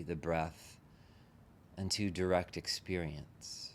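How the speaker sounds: unhurried meditation guide, long pauses between phrases; guided meditation. Real, not acted. Teacher leading a group into relaxing the body, guided meditation. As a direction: gently talk them into settling, leaving space after each phrase.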